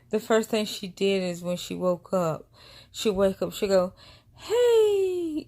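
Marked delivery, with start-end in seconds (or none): 4.4-5.5 very high, loud, long squeal; high-pitched baby greeting. Retold or imitated. Baby waking beside her in bed, high-pitched squeal. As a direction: imitate a baby squealing hello. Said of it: "It's so funny like that".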